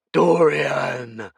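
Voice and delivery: loud angry call; shout. Acted. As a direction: bellow the name. Yama shouts Dorian's name.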